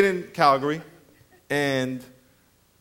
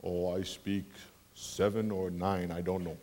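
casual bellman reply; 13 semitones lower, 12 dB quieter, slower than usual. Retold or imitated. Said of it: "How many languages do you speak?".

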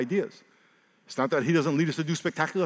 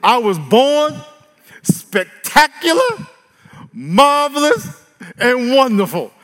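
slow, loud and much higher, each word stretched; loud declaration; 9 semitones higher, 9 dB louder, much slower than usual. Shown as a demonstration. Pastor leading the congregation in a loud declaration. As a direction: shout it over yourself like a promise.

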